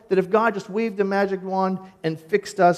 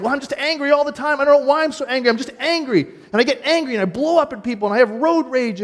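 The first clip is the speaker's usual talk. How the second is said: higher, imitating an exasperated angry person; mock angry complaint; 6 semitones higher, 3 dB louder than usual. Preacher acting out an angry churchgoer, mock frustrated.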